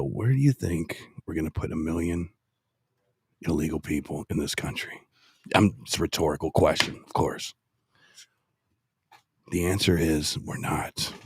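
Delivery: low, measured tough-guy speech; deep and deliberate, with heavy pauses; meat voice. Shown as a demonstration. Demonstrating the candidate's border speech to camera, low meat voice. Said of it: "with your meat voice".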